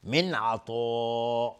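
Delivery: من عطا slow, with a heavy emphatic ط. Shown as a demonstration. Teacher repeating the word to show the heavy letter. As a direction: thicken the ط in عطا.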